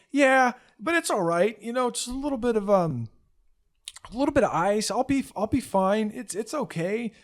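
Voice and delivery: put-on high, quick brave-faced boy voice; forced smile. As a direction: smile through the pain. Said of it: "trying to keep up a good, good smile".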